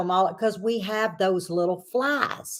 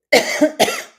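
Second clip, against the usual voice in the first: dry choking cough; 6 semitones higher, 4 dB louder than usual. Real, not acted. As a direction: cough out the wrong swallow. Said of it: "I'm about to choke".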